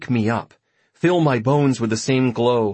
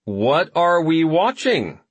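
slow, higher cried question; 6 semitones higher, slower than usual. Acted. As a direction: call it out eagerly. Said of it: "I cried".

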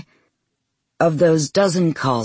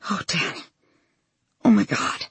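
breathless, whispered shock near tears; tearful prayer; 9 semitones higher than usual. Acted. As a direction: gasp it out as tears come. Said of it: "my words a prayer, tears springing to my eyes".

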